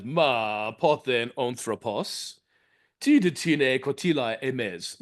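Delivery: slow, careful Doric Greek recitation; reciting Praxinoa's retort slowly; Greek verse read aloud. Shown as a demonstration. Lecturer to audience, reading Praxinoa's reply in slow Doric Greek. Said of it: "To which Praxinoa replies".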